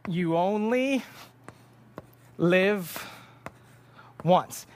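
each word with a long pause; drawn out with heavy pauses between words; spelled out slowly. Retold or imitated. Elena explaining yolo, slow with long pauses.

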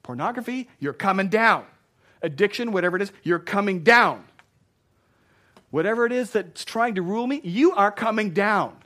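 loud, high preacher's shout; worked-up shouting. Real, not acted. Preacher orders addiction to fall, worked-up shout. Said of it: "I'm getting worked up up here".

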